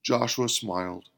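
ordinary delivery, nothing marked

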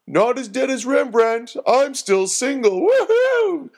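high, loud gloating that climbs into a whoop; gleeful taunt. A narrator's reading. Prankster Herbie teasing Doreen as he runs, gleeful. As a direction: crow it high, ending on a wild woohoo. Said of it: "laughed Herbie".

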